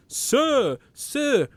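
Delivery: high, mocking sir, sir; mock polite call. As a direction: mimic him calling sir. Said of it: "you've gone".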